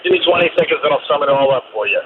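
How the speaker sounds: normal talking voice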